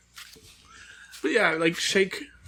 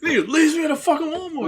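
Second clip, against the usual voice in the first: mock outrage; 11 semitones higher, 5 dB louder than usual. Voicing abandoned Stone Cold.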